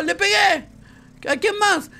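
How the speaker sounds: normal talking voice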